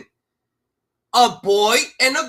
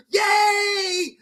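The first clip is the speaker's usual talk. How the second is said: high, loud mocking cheer; 9 semitones higher, 4 dB louder than usual. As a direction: shout a fake happy cheer. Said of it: "Everybody's like".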